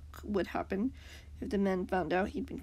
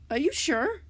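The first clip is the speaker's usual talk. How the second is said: nervous; 6 semitones higher, 6 dB louder than usual. A narrator's reading. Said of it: "Beat-Up said nervously".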